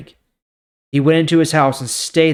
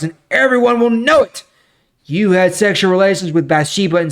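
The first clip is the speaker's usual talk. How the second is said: higher and louder, a stern prophetic rebuke; proclaiming judgment on David, raised and stern; forceful scripture reading; 5 semitones higher, 5 dB louder than usual. Acted. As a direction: read it like God pronouncing judgment on a king. Lord's judgment through Nathan.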